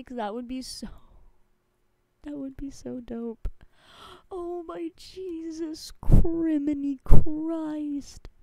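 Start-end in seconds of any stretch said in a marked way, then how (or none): 4.3-8.3 slow shocked exclamation. Real, not acted. Streamer reacting to chat chaos, slow shocked exclamation. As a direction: stretch it out loud and slow in disbelief.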